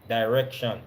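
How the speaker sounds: ordinary talk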